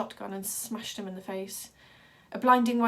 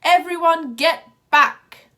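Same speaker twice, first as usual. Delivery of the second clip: slow, much louder roar in a different voice; 8 semitones higher, 16 dB louder, slower than usual. A narrator's reading. Ringmaster ordering the crowd back, roaring. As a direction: bellow the command. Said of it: "a voice roared".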